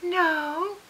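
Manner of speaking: high, sugary Southern mother; butter-wouldn't-melt Southern denial; sweet Southern voice. Retold or imitated. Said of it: "so sweet butter wouldn't melt in her mouth Southern voice".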